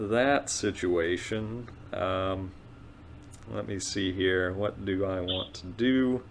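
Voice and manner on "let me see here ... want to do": usual speaking voice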